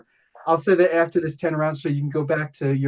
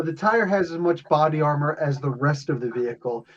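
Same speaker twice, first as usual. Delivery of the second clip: ordinary delivery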